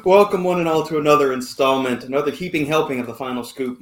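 host intro. Acted. Podcast host opening the show.

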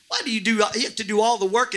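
ordinary delivery

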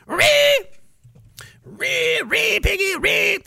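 high, squealed mock pig noises; piggy squeal. Host mimicking a piggy, high squealing.

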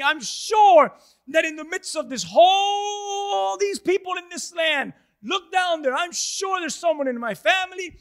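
high, loud, frantic begging; hammed-up panicky Moses; desperate pleading. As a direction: beg someone else be sent, near crying. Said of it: "He's basically saying".

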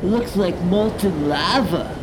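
loud laid-back surfer bro; surfer dude. Acted. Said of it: "the surfer voices".

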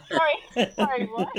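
laughing hard, breaking up; can't get the words out for laughing; real laugh through the apology; real laughter. Real, not acted. Laughing at the memory.

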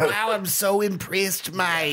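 slow, laughing Australian bloke; mock Australian. Mocking the climber as an Australian, slow and laughing. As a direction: drawl it out laughing like an Aussie.

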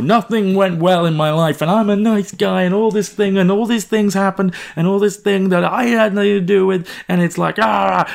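high-pitched, self-pitying mock rant; retold drunken rant. Drunk man ranting to his friend about his life, imitated rant. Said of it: "I'm just ranting at you".

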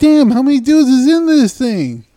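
high loud mock outrage at the phone; retold exasperated outburst. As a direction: blurt it out in disbelief.

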